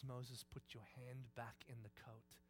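slow, low, very soft order; hushed, measured instruction; quiet command. Acted. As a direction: voice God speaking quietly and deliberately. God's quiet order in hushed scene.

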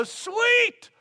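high, excited shouts; delighted high yell. Pretend. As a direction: cheer her on, thrilled. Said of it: "I'd go".

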